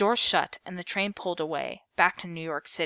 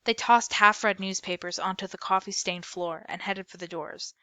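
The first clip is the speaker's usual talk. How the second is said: usual speaking voice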